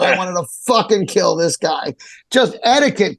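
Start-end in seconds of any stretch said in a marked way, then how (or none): none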